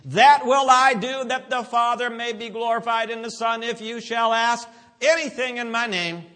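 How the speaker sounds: high, loud, sustained chant-like reading; forceful scripture preaching. Real, not acted. Preacher reads Jesus' promise to church, forceful proclamation.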